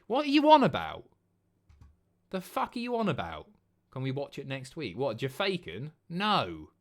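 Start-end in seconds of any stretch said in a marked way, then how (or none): 0.1-3.5 incredulous. Real, not acted. Streamer baffled by chat.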